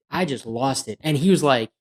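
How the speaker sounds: ordinary talk, nothing marked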